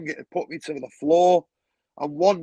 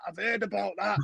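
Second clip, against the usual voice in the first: quieter echo; 3 semitones higher, 6 dB quieter than usual. Retold or imitated. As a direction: mutter it back to yourself. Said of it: "And he went".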